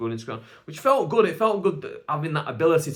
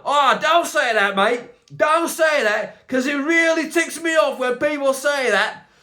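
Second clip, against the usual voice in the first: much higher and louder exasperated tirade; indignant rant; 11 semitones higher, 7 dB louder than usual. Real, not acted. Comedian ranting at the takeaway server about garlic mayo, loud and exasperated. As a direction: tell off the server who got the order wrong.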